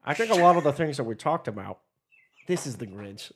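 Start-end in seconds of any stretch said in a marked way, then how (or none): 2.5-3.3 lower, softer cartoon grouch voice; lightly voiced grumbling Christmas villain; Grinch. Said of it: "I can do a good Grinch accent".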